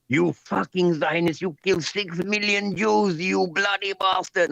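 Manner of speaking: high, slow mock tirade; imitated old man ranting. Retelling an old man's abuse at the protest, high-pitched ranting impression. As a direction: drag out the accusations like an outraged old man.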